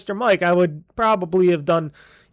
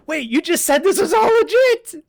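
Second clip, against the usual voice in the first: incredulous; 10 semitones higher, 4 dB louder than usual. Retold or imitated. Incredulous reply to the lady.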